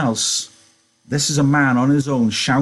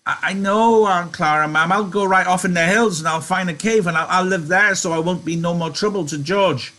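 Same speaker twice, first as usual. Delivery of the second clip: high-pitched grieving Lenny; high, plaintive character lament; moaning with grief; 6 semitones higher than usual. A narrator's reading. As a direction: moan the words in a high voice. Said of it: "he moaned with grief".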